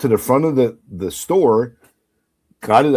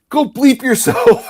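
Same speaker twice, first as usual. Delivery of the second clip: mock angry insult; 10 semitones higher, 5 dB louder, slower than usual. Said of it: "he goes".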